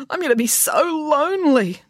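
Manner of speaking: high-pitched hammed-up lament; wailing. Binky grabbing Melanie as she leaves, wailing. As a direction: bemoan it in a high wail. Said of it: "wailed Binky".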